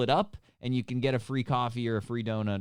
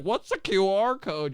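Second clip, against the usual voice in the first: higher, puzzled mock voice; confused old customer; 6 semitones higher, 4 dB louder than usual. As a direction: play the lost customer who doesn't get it. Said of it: "They're like".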